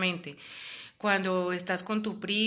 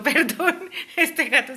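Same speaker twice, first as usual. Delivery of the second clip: real laugh of surprise, higher and louder; startled laugh; 8 semitones higher, 9 dB louder, slower than usual. Real, not acted. Woman startled by her cat laughs an apology. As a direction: laugh it off after a fright.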